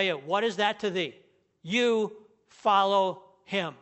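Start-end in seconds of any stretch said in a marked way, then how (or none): 1.6-3.7 You follow him, slow and louder; stress on You. Shown as a demonstration. Preacher applying the verse, slow and emphatic on You. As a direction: give You a loud, slow weight. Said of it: "That's what Jesus is saying".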